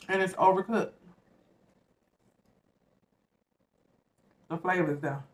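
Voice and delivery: talking while chewing fries; eating between words; mouth full, chewing. Real, not acted. Seen 2: Chews fries throughout and bites one at 2.6; the sandwich is untouched.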